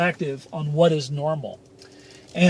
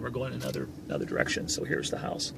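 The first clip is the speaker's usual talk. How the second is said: much softer, trailing murmur; quiet aside; 6 semitones lower, 11 dB quieter, faster than usual. Real, not acted. Telling viewers while driving, softly murmured. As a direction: mumble it to yourself while steering.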